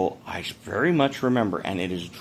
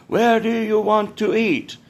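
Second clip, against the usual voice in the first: high, slow, raised group chant; imitated crowd chorus; 13 semitones higher, 4 dB louder, slower than usual. Crowd of diplomats asking together, high slow mock chorus. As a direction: call it out like a whole crowd at once. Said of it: "eventually a whole crowd of people".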